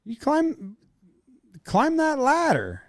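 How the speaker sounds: high, drawn-out character voice; silly high-pitched voice, slowly; put-on character voice. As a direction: pitch it way up and stretch the words. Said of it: "Tell it in your Norm voice".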